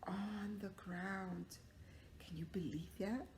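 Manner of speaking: slow hushed whisper. Acted. Teacher whispering to kids about the fall. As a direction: whisper the shocking result.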